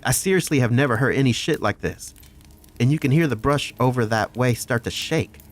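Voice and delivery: usual speaking voice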